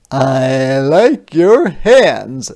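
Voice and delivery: put-on slow, loud, drawn-out child voice; loud slow kid voice; classmate's compliment. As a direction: say it slowly like a shy kid. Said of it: "said Raphael".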